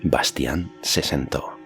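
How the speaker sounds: plain reading voice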